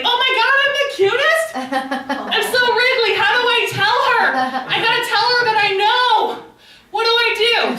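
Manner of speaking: very high, excited squeaky dog voice; overjoyed dog. Acted. Dog learning he's the cutest, very high excited voice. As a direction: voice a happy puppy bursting with news. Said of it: "he was so happy. He was just like".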